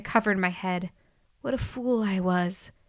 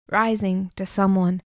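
usual speaking voice

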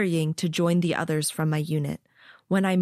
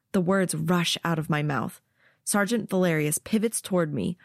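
plain reading voice

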